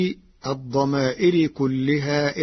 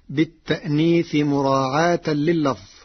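ordinary talk

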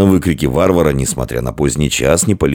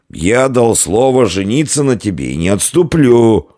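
put-on high, impassioned drunken vow; heartfelt, pitched-up drunk proclamation; Феодогат pouring out his soul; 8 semitones higher, 3 dB louder than usual. A narrator's reading. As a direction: declare it loudly with maudlin fervour. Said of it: "продолжал изливать душу Феодогат".

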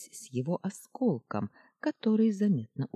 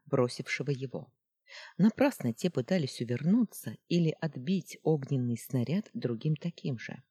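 ordinary delivery, nothing marked

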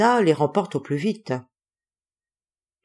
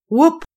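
cri de singe aigu; 12 semitones higher, 6 dB louder than usual. Acted. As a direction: whoop aigu et fort. (whoop de singe, haut et fort)

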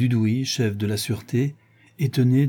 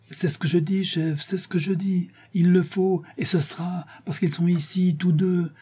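high-pitched, agitated insistence without shouting; obstinate insistence; 8 semitones higher than usual. A narrator's reading. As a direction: insist doggedly, voice held high. Frustrated inspector insists to his chief.

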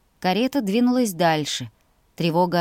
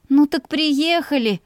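high, drawn-out, exasperated moan; groaning complaint; 8 semitones higher, 4 dB louder than usual. A narrator's reading. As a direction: wail it slowly in impatience. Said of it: "простонала я".

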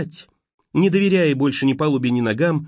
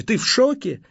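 panicked question; 10 semitones higher than usual. A narrator's reading.

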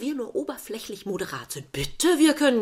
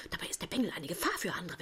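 whisper; 4 semitones lower, 11 dB quieter, faster than usual. Acted. Flüsternder Ehestreit.

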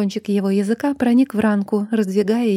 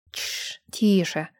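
whispered shush, then a low 'quieter'; soothing hush; 3 semitones lower, 5 dB quieter, much slower than usual. A narrator's reading. Man calming the girl in pain, soothing hush. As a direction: hush her softly.

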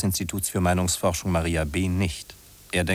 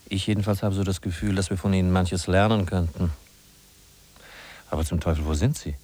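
normal talking voice

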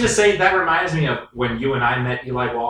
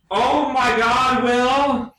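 exasperated cry; 9 semitones higher, much slower than usual. Acted.

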